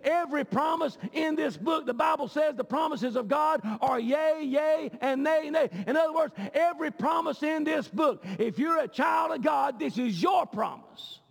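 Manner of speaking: shouted sermon climax; preaching at full force. Acted. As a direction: drive the promises home to the congregation.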